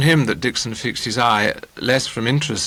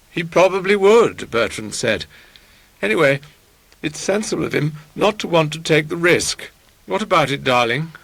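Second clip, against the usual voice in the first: higher-pitched, emphatic suitor; raised, self-assured character voice; Bertrand's pompous drawing-room voice; 5 semitones higher than usual. A narrator's reading. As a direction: play him loftily sure of himself.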